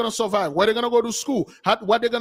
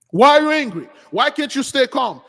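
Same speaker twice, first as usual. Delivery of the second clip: higher and louder, throwing the question back; mock indignant shout; 6 semitones higher, 5 dB louder than usual. Mocking those who say stay calm, loud and high. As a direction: shout it like an outraged critic.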